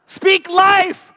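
loud, high rallying shout. Acted. Preacher shouting a call for the crowd to echo, loud.

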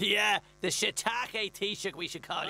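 mocking laugh. Mocking the Taoiseach.

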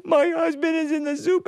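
high comic wail; crying. Acted. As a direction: wail it out for the whole barnyard. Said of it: "why is everybody crying".

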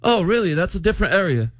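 high mock-British small talk; British accent. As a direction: react in a put-on British voice. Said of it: "Y'all have a British off right now".

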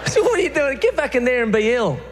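scolding smokers outside the hospital, high and bossy; scolding nurse. Retold or imitated. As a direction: shoo the patients back inside. Telling off smoking patients.

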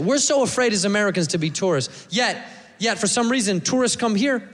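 ordinary talk

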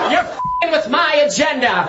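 high, slow indignant squeal, laughing through it; outraged passenger. Retold or imitated. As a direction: whine it like an entitled lady. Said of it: "She was like".

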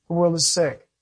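normal talking voice

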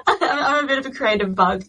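giggling self-deprecation; laughing. Real, not acted. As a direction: laugh through the line. Laughing at herself mid-conversation.

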